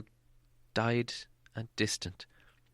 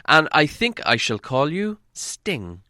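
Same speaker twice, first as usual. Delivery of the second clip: slow, higher and louder, a solemn proud hobbit; name the sword with slow pride; proud naming; 6 semitones higher, 6 dB louder, slower than usual. Acted. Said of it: "he said to it".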